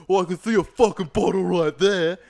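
loud, high mock outburst; indignant shout. Stranger refused a drink spots the bottle, indignant mock shout. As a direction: imitate an indignant scrounger. Retold man demands a drink.

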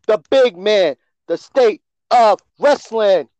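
booming ring announcer build; ringmaster. Acted.

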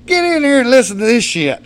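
high, loud holler to come listen; excited summons; calling someone over. Retold or imitated. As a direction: holler it toward the next room. Calling stepmom to hear news.

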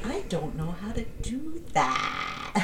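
slow creaky whine; vocal fry. Shown as a demonstration. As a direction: croak it out slowly, half crying. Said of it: "There it is".

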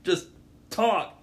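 high, loud mock frustration; exasperated outburst. Fed up with rhythmless talkers, loud mock exasperation. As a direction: burst out impatiently, punching the last word.

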